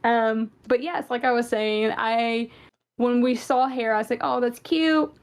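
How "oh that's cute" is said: ordinary delivery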